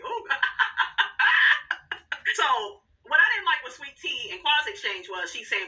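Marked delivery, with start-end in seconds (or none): 0.3-2.3 laugh. Real, not acted. Laughing at the shots-fired moment.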